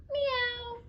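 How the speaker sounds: squeaky high cat meow; playful high meow. Acted. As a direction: meow like a kitten. Dog makes cat sound.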